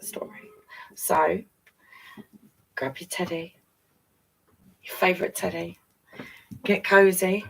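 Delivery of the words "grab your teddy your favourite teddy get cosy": plain reading voice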